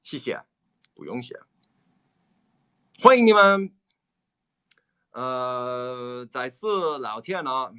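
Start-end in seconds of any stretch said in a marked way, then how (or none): 3.0-3.7 cheery welcome. Acted. Cheerful greeting in Mandarin.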